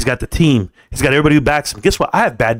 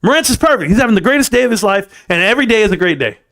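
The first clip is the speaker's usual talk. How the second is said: mock gushing fan, pitched much higher; hammed-up admiring fan voice, high and bright-eyed; imitating a fan who thinks he's perfect, voice pushed much higher; mocking idealized fan; 7 semitones higher than usual. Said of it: "make you feel like".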